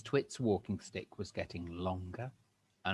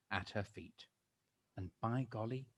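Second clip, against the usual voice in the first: ordinary delivery, nothing marked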